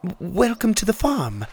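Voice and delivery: high, friendly hen voice; a hint of a clucking hen greeting; pleasant clucking hen. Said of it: "Raina the hen clucked pleasantly".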